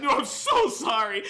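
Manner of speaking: loud, high, slow panicked apology; acting out frantic apologizing; imitated freak-out. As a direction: blurt a mock-panicked plea, stretched out. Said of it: "he just starts freaking out".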